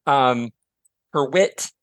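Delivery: usual speaking voice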